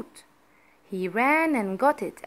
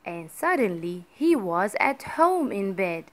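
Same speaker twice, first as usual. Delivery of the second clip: ordinary delivery, nothing marked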